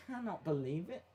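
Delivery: quiet, under the breath; muttered. Real, not acted. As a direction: mutter it to yourself. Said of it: "I cannot believe it".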